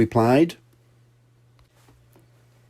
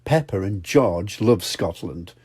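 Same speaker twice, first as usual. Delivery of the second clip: usual speaking voice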